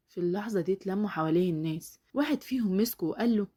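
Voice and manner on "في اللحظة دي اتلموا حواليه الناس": plain reading voice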